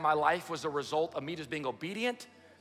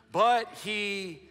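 higher, louder, slow Bible reading; dramatic scripture reading; 4 semitones higher, 4 dB louder than usual. Acted. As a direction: proclaim the verses slowly to the church. Reads Genesis aloud to congregation.

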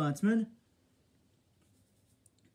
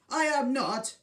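fuming; 7 semitones higher, 3 dB louder than usual. Acted. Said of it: "Gordon was fuming".

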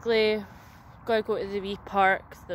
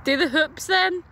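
calling out; 9 semitones higher, 7 dB louder than usual. Real, not acted. Calling across playground.

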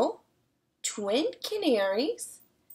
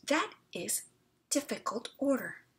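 softer, thoughtful man; pondering; 6 dB quieter than usual. Acted. Strange man pondering the hen's order, softer. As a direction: muse over it quietly.

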